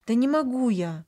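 громче и выше, на грани истерики; выкрикни с истеричными нотками; истеричные нотки. A narrator's reading. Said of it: "С истеричными нотками в голосе возмутилась". (возмущённо, с истерикой в голосе)

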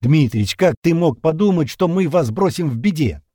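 high, loud, astonished question; sincere surprise. A narrator's reading. Sergei cannot believe the captain doubts him, surprised. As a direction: ask it as if shocked by the very idea. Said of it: "Искренне удивился Сергей".

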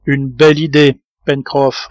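plain reading voice

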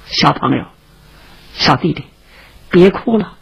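low, soft coaxing; calm a frightened child, voice dropped and softened; gentle soothing. Acted. Rescuer soothes a terrified, crying child.

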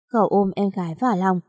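usual speaking voice

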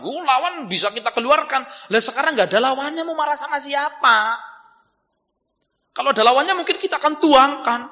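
high-pitched, animated preacher's voice; insistent rhetorical preaching; heated preaching. Acted. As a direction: argue the point with rising heat. Preaches with raised voice.

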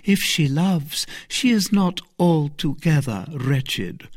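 a slow, sighing Milady; a sigh of sad envy, slow. A narrator's reading. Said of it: "said Milady with a sigh".

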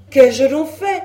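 loud, high wail of protest; escalated, hapless outcry; distressed cry. A narrator's reading. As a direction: cry out as the wretched accused. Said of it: "s'écria le malheureux Le Gall".

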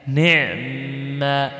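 slow chanted نعم, ni'-mma with long humming m; correct iskan. Shown as a demonstration. Teacher restating the correct form, drawn-out iskan. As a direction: close the ayn in نعم and linger on the m. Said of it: "تسكن الحرف وتشدد الحرف الذي بعده".

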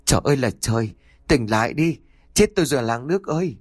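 wife shouting her husband awake in panic; panicked village-wide cry; frantic calling out. A narrator's reading. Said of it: "giật mạnh mà hô lớn".

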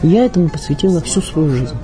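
ordinary talk

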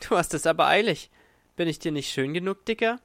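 coy mocking bar girl, Dicker? jumping way up; teasing showgirl. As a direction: needle him with quick playful scorn. Girl teasing the fat winner.

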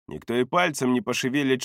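usual speaking voice

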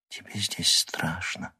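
slow, sorrowful unvoiced whisper; sad whisper. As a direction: whisper it slowly and sadly. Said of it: "печально прошептала Лиза".

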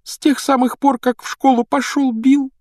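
put-on high-pitched lamenting girl; tearful. Girl dreading telling her parents she must leave, tearful and high. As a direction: wail it plaintively, voice way up. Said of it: "плакала Джинни".

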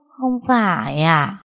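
slow, let-down question; deflated voice. A narrator's reading. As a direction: sag with disappointment. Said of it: "Giọng nói ỉu xìu". (giọng ỉu xìu, chậm và thất vọng)

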